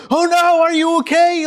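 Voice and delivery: high, loud gasping concern; overdone alarm. Shown as a demonstration. Preacher mimicking a parent fussing over a fallen toddler, high alarmed fuss. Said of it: "you get in their face and you're like".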